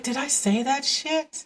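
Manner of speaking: high, soft, slow disbelief; disbelieving at oneself. Retold or imitated. Mother recalling her own scolding words, softly incredulous.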